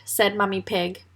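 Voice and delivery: ordinary delivery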